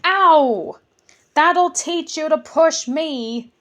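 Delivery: high, loud hurt goblin yell; yelp, then yell back. Acted. Goblin hit by the other one, yelling back. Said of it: "yelled the other one".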